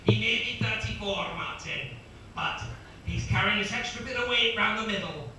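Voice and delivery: put-on pundit's commentary in an unconvincing Scottish accent; badly attempted Scottish. Co-commentator telling Martin about the racer's weight, botched Scottish. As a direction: start loud, keep faking the Scottish analyst. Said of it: "Imagine he sounds Scottish".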